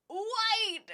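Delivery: high, breathy gasp then cry; sudden real surprise; gasp of realization. Real, not acted. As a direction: react as if struck by genius. Sudden idea about painting bricks.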